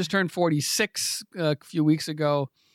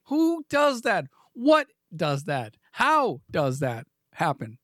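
slow mock outrage; 2 semitones higher, slower than usual. Real, not acted. Mock rhetorical questions.

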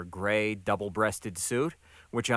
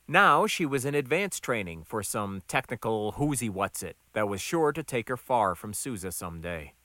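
plain reading voice

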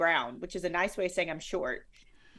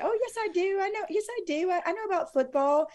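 indignant retort; 13 semitones higher than usual. Retold or imitated. Her retort to the caller.

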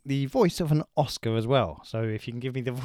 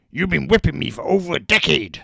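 louder, grouchy muppet growl; Oscar the Grouch; 5 dB louder than usual. Acted. Quiz host voicing Oscar the Grouch for a clue. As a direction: grumble it like a grumpy muppet in a bin. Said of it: "Oscar the Grouch is correct".

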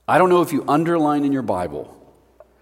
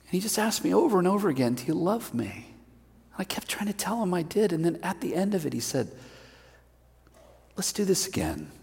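soft, tender Peter, much quieter; humbled Peter; 5 semitones higher, 5 dB quieter than usual. Acted. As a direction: speak as if still moved. Peter recalls being restored.

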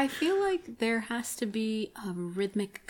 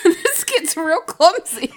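slow, laughing, much louder; 8 semitones higher, 14 dB louder, slower than usual. Real, not acted. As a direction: say it while cracking up. Commenting while laughing.

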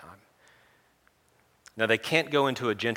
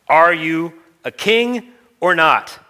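slow, loud, higher, each word spelled out; exasperated demand; 8 semitones higher, 13 dB louder, much slower than usual. Acted. Pilate demanding an answer from Jesus, slow exasperated shout. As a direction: lose your patience completely.

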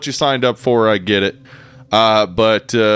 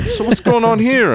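mock British judge; 11 semitones higher than usual. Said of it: "they'd be like".

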